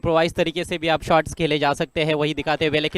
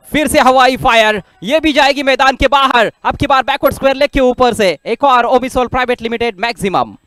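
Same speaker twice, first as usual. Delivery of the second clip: loud, high call of the big hit; excited cricket commentary; 5 semitones higher, 8 dB louder than usual. Real, not acted. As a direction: shout the six as it flies. Live commentary of a six. (जोशीली cricket commentary)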